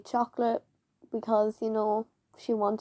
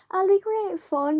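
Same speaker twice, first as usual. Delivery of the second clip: cajoling teacher; 9 semitones higher, 4 dB louder than usual. Retold or imitated.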